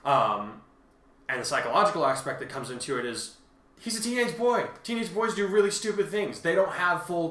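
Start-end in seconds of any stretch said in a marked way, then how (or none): 3.8-7.3 much higher, louder exasperated jab. Real, not acted. Exasperated remark about teenage boys.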